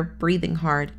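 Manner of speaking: usual speaking voice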